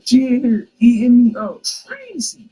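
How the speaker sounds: loud and slow, dragging out the brag; loud slow taunt. Acted. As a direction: lean on every word to rub it in. Taunting the angry rival.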